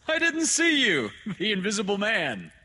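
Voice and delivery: very high, loud, jovial doctor; booming joviality. Acted. Doctor joking with the overlooked father, loudly jovial. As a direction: call it out as a big hearty joke.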